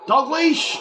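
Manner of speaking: high, loud call of a name; real shout at the screen; excited shout. Real, not acted. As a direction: yell the player's name. Yelling at player.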